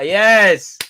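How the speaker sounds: big, high shout of joy; loud cheer. Real, not acted. Fan cheering as rivals cry, loud shout. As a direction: yell it out in triumph.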